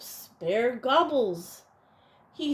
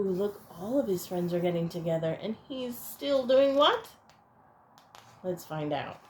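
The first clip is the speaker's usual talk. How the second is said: ordinary delivery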